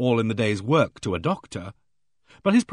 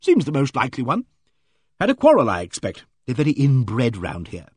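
hearty bossy lady; 4 dB louder than usual. Acted. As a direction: play her booming and self-assured. Aimee Griffith's hearty voice.

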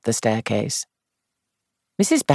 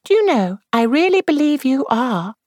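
put-on young woman voice; 13 semitones higher than usual. A narrator's reading. Jane voiced high.

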